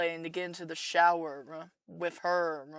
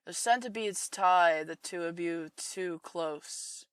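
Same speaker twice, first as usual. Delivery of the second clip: plain reading voice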